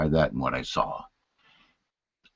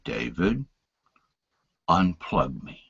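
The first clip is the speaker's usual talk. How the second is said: much lower, softer; the last weak words of a dying father; faint final plea; 8 semitones lower, 8 dB quieter, slower than usual. Retold or imitated.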